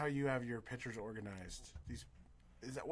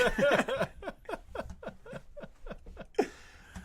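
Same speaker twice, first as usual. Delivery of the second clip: long hearty laugh. Real, not acted. Big laugh at own joke.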